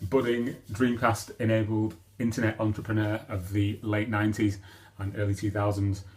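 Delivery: usual speaking voice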